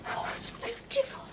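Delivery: breathless whisper of wonder; awed whisper. Acted. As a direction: whisper it in awe, high. Girl gasps over the gift.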